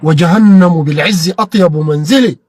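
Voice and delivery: slow, declaimed line of verse; solemn reading of an Arabic poem line; poem recital. Real, not acted. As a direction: recite it with pride and weight.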